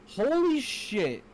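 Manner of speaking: slow, high, stretched-out exclamation; excited. Real, not acted. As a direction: draw it out in awe. Overwhelmed by excitement.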